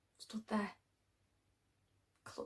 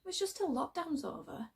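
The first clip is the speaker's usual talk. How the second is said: defensive; 7 semitones higher than usual. Acted. Defending her move to friend.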